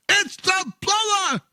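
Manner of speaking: high, slow frustrated yell; plumber snapping. Acted. Plumber losing his temper at the parrot, high yell. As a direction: shout it at the door at last.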